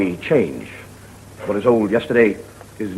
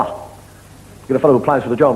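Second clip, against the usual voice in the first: ordinary talk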